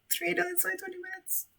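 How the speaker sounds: tiny, very high squeal; disbelieving squeak. Real, not acted. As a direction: squeak it softly in sheer disbelief. Repeats score in stunned squeak.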